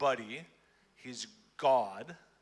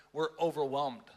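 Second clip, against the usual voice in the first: ordinary talk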